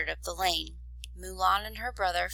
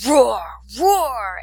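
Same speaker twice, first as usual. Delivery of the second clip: put-on loud, high little-boy roar; playful dragon roar; 4 semitones higher, 9 dB louder than usual. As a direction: roar like a small child playing dragon. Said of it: "he cried".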